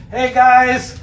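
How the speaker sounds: high, loud cheery Moses; eager prophet showing off his tablets; Moses announcing. Acted. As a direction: call out proudly to the people. Moses voiced coming down mountain.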